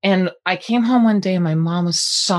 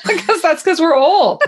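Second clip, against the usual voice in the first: laughing while talking; 14 semitones higher, 4 dB louder than usual. Real, not acted. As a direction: talk through real laughter. Laughing at being old.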